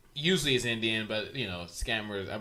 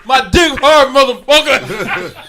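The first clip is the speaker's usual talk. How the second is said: very high, very loud shouted threat; mock furious yell; 11 semitones higher, 18 dB louder, slower than usual. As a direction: bellow it like the guy in the prank call. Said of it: "revert back to his voice".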